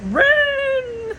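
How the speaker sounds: long, high held imitation shout; imitated drawn-out holler; mock scream. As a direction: stretch it out as his mimicked yell. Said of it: "he's screaming".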